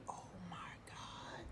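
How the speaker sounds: hushed, breathless "Oh my God"; stunned whisper to the viewers. Real, not acted. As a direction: whisper it in disbelief. Shocked aside at ending.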